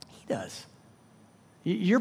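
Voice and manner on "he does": much softer, breathless whisper; whispered answer. Real, not acted. Preacher answering who carries the problems, whispered. Answering his own question.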